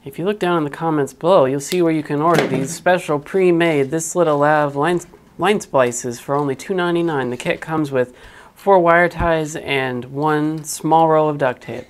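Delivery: infomercial voice. Pretend. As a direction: sell it like a cheesy infomercial. Fake product ad for hack job.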